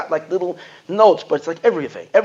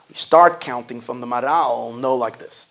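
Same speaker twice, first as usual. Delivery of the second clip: ordinary talk, nothing marked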